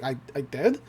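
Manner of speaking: low, soft, hesitant question; mock-bashful and quietly unsure; timid disbelief. Zeus doubts his own success.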